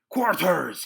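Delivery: loud, high barked command; roared order. A narrator's reading. Captain roaring at his deserting men, loud. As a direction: roar the men to their posts. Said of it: "he roared".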